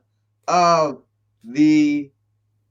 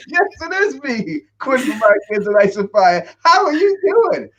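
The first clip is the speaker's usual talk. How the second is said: retold hearty greeting; 6 semitones higher than usual. Acts himself greeting the fan.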